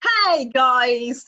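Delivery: usual speaking voice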